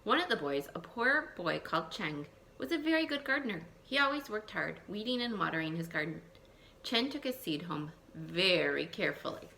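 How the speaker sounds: put-on lively read-aloud for young children; storybook reading. Teacher reading a folk tale to her class, lively storytelling.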